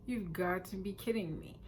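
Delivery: retold disbelief, very soft; hushed, understated incredulity; quiet disbelief. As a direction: say it under your breath, incredulous. Stunned at being refused help.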